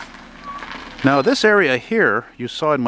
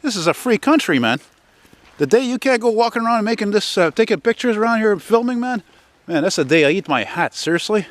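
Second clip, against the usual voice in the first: retelling a proud comeback to his brother, raised and defiant; defiant retort; 8 semitones higher than usual. Retold or imitated. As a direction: fire back like nobody can stop you. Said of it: "I said".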